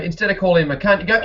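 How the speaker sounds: usual speaking voice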